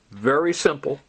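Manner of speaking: ordinary delivery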